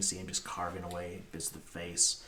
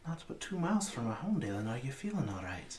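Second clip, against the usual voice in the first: higher-pitched woman checking on her partner; woman character; 6 semitones higher, 3 dB louder than usual. Acted. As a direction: voice her high, easing down into the question. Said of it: "She goes".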